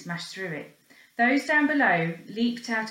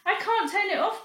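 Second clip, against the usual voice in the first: high, alarmed cry; panicked cry; 12 semitones higher, 3 dB louder than usual. Acted. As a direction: cry out helplessly. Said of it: "cried George".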